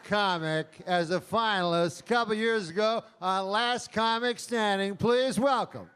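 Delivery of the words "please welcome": usual speaking voice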